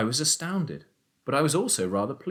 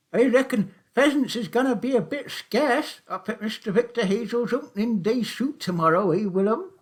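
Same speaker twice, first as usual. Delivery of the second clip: put-on high, loud old countryman's voice; sly old taxi driver; 13 semitones higher, 6 dB louder than usual. A narrator's reading. Old Charlie teasing Willum about the shoot, high and loud. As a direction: play the crafty old poacher, much higher and louder.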